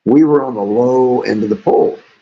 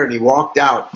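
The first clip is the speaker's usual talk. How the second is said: normal talking voice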